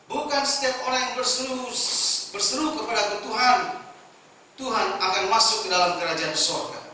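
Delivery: loud, emphatic Bible reading; booming preacher's verse reading; scripture reading. Acted. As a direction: proclaim the verse to the congregation. Preacher reads Bible verse aloud.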